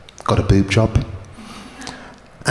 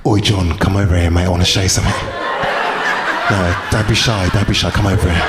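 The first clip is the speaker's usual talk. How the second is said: blokey Cockney; 4 semitones lower than usual. Retold or imitated. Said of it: "if guys did that".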